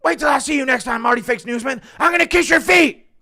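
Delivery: high, loud shouted fighter trash talk; Colby Leftist impression. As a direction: shout like a hyped fighter calling out a rival. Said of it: "Colby Leftist impression?".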